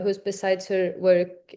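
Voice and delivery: ordinary delivery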